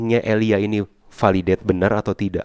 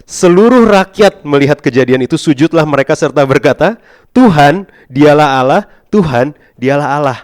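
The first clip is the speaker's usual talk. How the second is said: ringing out high and loud; full-voiced scripture reading; loud preaching read; 6 semitones higher, 12 dB louder than usual. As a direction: proclaim it like the crowd crying out. Preacher reads the people's cry.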